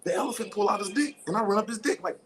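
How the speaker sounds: usual speaking voice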